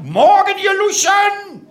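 high, slow roared greeting; drill sergeant roar. Shown as a demonstration. Ausbilder Schmidt roars a greeting at the audience. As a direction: bellow at the recruits. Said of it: "brüll die Leute an".

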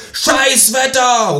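slow, high shouted protest; loud call. Acted. Narrator protesting at the empty demo, slow loud shout. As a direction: draw it out like a protest chant. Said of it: "rufe ich laut".

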